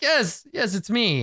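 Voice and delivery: very high, pitiful admission; cowed confession. Acted. Prisoner admitting the poster shows him, cowed high voice. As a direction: give in meekly in a high voice.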